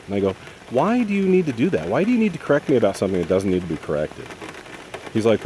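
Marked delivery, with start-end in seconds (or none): 0.7-4.3 high, loud exasperated WHY, then quicker; exasperated protest. Retold or imitated. Son retelling a quarrel with his father, exasperated. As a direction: retell the complaint as fed up and incredulous. Retold quarrel over being corrected.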